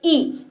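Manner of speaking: ई said short and quick; short vowel. Shown as a demonstration. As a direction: say ई briefly, without stretching it. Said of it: "इसके उच्चारण में कम समय लगता है". (छोटी, कम समय वाली ई)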